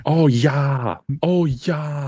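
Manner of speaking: hammed-up Minnesota accent; loud mock Minnesota accent, vowels stretched. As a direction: imitate a folksy Minnesotan. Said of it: "Minnesota, nice accent".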